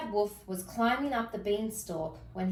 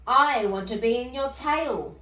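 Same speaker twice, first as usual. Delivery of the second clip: growled; 3 semitones higher, 6 dB louder than usual. Acted. Wolf growling at Cinderella, a menacing growl. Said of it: "he growled".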